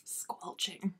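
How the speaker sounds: very soft, voiceless murmur; sly whispered aside. Real, not acted. Co-host teasing about a terrible love scene, sly whisper. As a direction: whisper it slowly with relish.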